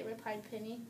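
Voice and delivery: usual speaking voice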